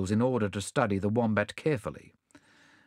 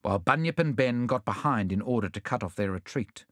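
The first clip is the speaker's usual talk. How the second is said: usual speaking voice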